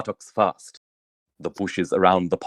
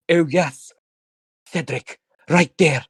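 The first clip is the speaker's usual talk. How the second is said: breathy passion; 7 semitones higher than usual. Acted. Passionate woman voiced in tent.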